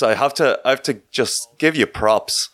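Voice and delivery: usual speaking voice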